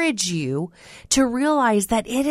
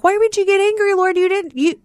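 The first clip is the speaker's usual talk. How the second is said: much higher, louder mock protest; indignant protest; 11 semitones higher, 4 dB louder, faster than usual. Preacher mock-complaining to God, high and loud. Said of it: "And I'm like".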